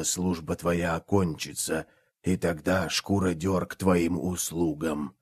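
low, very soft and otherworldly; death god voice. Acted. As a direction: speak as an ancient god of death, hushed and deep. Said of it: "Голос повелителя смерти достиг второго".

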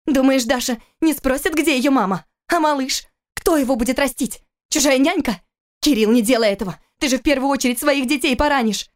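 high, urgent pleading outcry; crying out. A narrator's reading. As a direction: fire anguished questions, pitched well up. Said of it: "закричала она". (взволнованно, почти крича)

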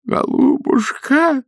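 high, slow, melting coo; touched priest. Acted. Priest cooing to the lady of the house. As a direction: gush with tender emotion. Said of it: "умилился отец Фёдор".

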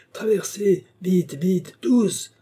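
high, loud hurried commands; urgent shouted orders. Acted. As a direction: get them moving now. Officer hurrying everyone across.

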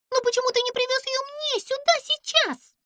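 high squeaky voice, quick and fussy; Pimpochka, fussy little creature. A narrator's reading. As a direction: play the tiny nervous girl. Pimpochka demanding. (высокий писклявый голос)

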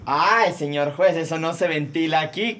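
usual speaking voice

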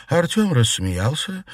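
ordinary delivery, nothing marked

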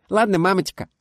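put-on high, loud child voice; obliging little girl. A narrator's reading. Daughter agreeing to her mother's request. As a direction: answer mum sweetly and brightly.

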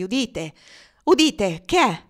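high, loud urgent call to listen; sudden alarm. A narrator's reading. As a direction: startle him into listening. Cassie suddenly hears something, urges listening. (allarme improvviso, voce alta)

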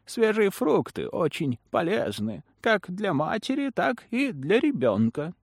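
put-on high, slow, honeyed old woman; sweet, sinister smiling crone; Ламия. A narrator's reading. Lamia speaking, same voice. (приторный голос старой ведьмы)

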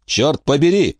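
короткий выкрик, чуть выше обычного; выругайся с досадой, но без крика; восклицание. A narrator's reading. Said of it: "воскликнул Крах". (досадливое «Чёрт побери!»)

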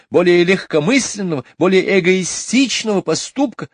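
high, loud indignant complaining; high outraged mother; indignant rant. A narrator's reading. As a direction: pile up the grievances. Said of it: "возмущалась миссис Банкс".

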